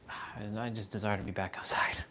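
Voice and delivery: whispered, like a secret wish; wistful whisper. Retold or imitated. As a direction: breathe it out in a whisper.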